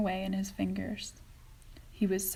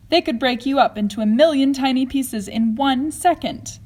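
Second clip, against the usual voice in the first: louder, sharp warning call; 4 semitones higher, 10 dB louder than usual. Acted. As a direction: call the boy back from danger. Wonka warning Mike off camera.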